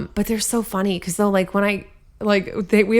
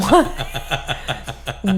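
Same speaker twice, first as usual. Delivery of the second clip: slow what, breaking into laughter; giggling what at dad's odd wish; laughing disbelief; 3 semitones lower than usual. Retold or imitated. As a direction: laugh through the question. Retold disbelief at dad's request.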